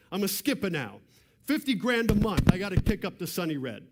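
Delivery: hammed-up New York mob accent, rising to a loud complaint. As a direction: play the irritable mob boss in a New York wiseguy accent. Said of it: "I'm trying to do the accent".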